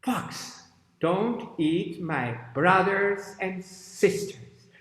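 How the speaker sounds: slow, emphatic storybook warning; warning crocodile. Acted. Crocodile defends its siblings from fox, slow emphatic warning. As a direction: warn the fox word by word. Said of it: "And the crocodile says".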